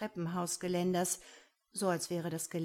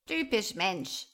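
slow, louder croaking raven; reproachful croak; 5 dB louder than usual. A narrator's reading. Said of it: "krächzt der Rabe vorwurfsvoll".

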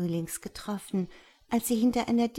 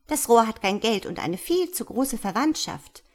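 high, louder twittering of gossiping swallows; chirping swallows; 5 semitones higher, 6 dB louder than usual. A narrator's reading. Gossiping swallows sneer at the love affair, high chirping. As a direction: chirp it out like a mocking bird flock. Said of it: "zwitscherten die anderen Schwalben".

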